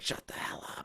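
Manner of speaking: hissed whisper, shutting him up; whispered hush. Retold or imitated. Liam hushing Todd so the ghost won't hear, in a sharp whisper. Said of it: "Liam's just being like".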